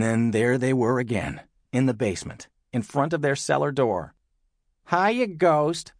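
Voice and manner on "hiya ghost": higher, taunting call; mock cheery cry. A narrator's reading. Lola taunting the ghost at the door, crying out. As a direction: call out teasingly, pitched up. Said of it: "cried Lola".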